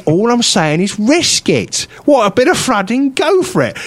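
increasingly high-pitched retold plea; exasperated urging. Re-enacts urging railway staff.

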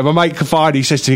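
usual speaking voice

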